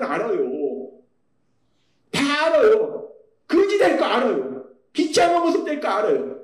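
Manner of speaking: impassioned sermon delivery; fervent preaching. Acted. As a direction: drive the point home from the pulpit. Preacher presses his point.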